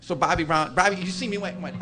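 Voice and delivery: ordinary talk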